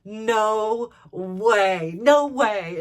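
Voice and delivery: loud, drawn-out amazement; retold excited disbelief. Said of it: "And she was so excited".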